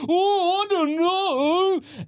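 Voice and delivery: very high, very slow fake innocence; mock innocent juror. Mocking the juror's fake innocence, high and drawn out. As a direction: stretch it out high, playing dumb. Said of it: "And he's like".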